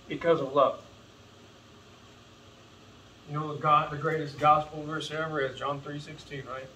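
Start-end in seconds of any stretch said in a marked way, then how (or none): none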